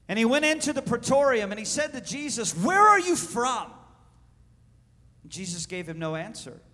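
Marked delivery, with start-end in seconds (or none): 2.5-3.7 higher, insistent questioning; pressing question. Acted. Pilate questioning Jesus in the praetorium, pressing question. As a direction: put the question high and urgently.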